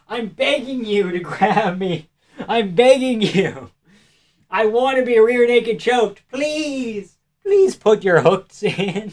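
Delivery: high, slow, hammed-up pleading; mock begging. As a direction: beg the fighter in a joking, whiny plea.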